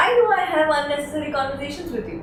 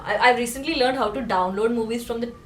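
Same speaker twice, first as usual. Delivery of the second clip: usual speaking voice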